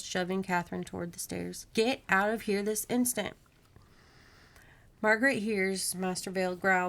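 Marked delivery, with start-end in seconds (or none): none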